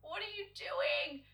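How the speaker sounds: high, slow disbelieving question; baffled mock question. Watching the artist cut concealer, high and slow in disbelief. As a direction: ask it slowly in disbelief. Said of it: "I'm just like".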